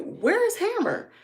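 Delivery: high, stretched-out demand; alarmed question. Retold or imitated. Owner comes out of coop looking for dog, alarmed high question. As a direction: ask it slowly, voice leaping up.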